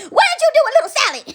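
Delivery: high, rushed, incredulous demand; mock accusation. Neighbour to the wolf about Little Sally, in mock accusation. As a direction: confront the culprit in hammed-up alarm.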